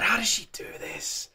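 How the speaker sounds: breathless whisper of disbelief; awed whisper. Real, not acted. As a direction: whisper it, stunned by the voice. Overwhelmed by the singing.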